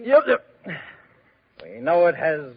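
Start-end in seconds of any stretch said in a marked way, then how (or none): 0.0-0.5 sudden high, loud yep yep; excited yelp. Acted. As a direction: blurt it out, much higher and louder. King blurts out excitedly.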